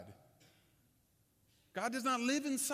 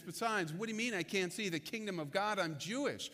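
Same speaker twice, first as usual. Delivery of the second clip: usual speaking voice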